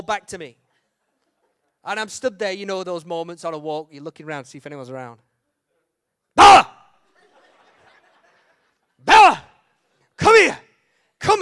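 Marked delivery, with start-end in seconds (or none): 6.4-11.4 full-throated high yell across the field; yelling for the dog. Retold or imitated. Owner yelling for runaway dog, loud call. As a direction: bellow the dog's name to make her come back.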